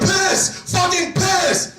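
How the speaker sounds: high, drawn-out shouted swearing; imitated Tourette outburst. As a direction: yell it like an uncontrollable tic. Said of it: "had Tourette syndrome".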